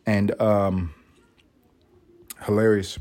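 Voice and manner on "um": normal talking voice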